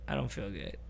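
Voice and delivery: retold queasy murmur; sick murmur. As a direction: mumble it like you're about to be sick. Stoned friend retelling feeling sick.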